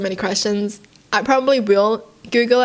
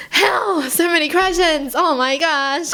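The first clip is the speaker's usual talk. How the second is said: much higher, drawn-out exasperated whine; baffled wail; 7 semitones higher, slower than usual. Real, not acted. Curious viewer overwhelmed by questions, baffled wail. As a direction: let the bewilderment rise into a high plaint.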